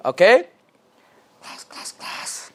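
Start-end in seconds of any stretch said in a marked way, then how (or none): 1.4-2.5 whispered call. Acted. Teacher attention call-and-response.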